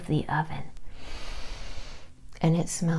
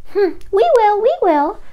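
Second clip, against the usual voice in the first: high, loud peeping chick voices; baby chick voices; 14 semitones higher, 8 dB louder than usual. Acted. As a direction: squeak it out like tiny chicks. Eager baby chicks answer.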